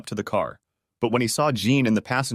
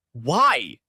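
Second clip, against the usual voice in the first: roaring why; 3 semitones higher than usual. A narrator's reading. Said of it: "she roared in my face".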